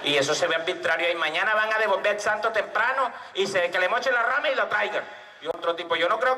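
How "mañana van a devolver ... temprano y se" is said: ordinary delivery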